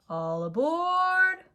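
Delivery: high, loud, drawn-out call to passengers; conductor calling out. A narrator's reading. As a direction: sing it out like a train conductor. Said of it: "calls the conductor".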